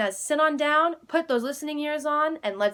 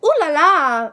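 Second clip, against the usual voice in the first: higher and louder, delighted ooh la la; 5 semitones higher, 8 dB louder than usual. Acted. Nancy admiring her dressed-up family, delighted. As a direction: relish the glamour of it. Exclaiming over posh family.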